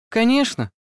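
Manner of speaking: bright-spirited, high-pitched answer; lively young woman's yes; perky reply. A narrator's reading. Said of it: "задорно ответила она".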